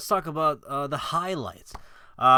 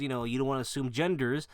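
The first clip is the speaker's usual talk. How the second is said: ordinary delivery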